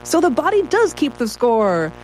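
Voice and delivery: high, drawn-out excited imitation; enthusiastic. Writer imitating social media commenters, mock enthusiastic. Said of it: "very enthusiastically saying".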